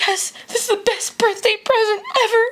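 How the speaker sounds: put-on very high, tearful child voice; squeaky mock-sobbing little girl; imitated happy crying. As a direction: imitate a kid wailing through happy tears. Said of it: "she couldn't even get a word".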